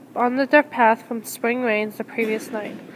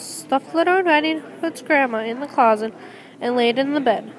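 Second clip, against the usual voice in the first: ordinary delivery, nothing marked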